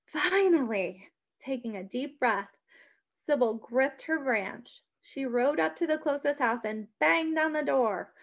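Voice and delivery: ordinary delivery, nothing marked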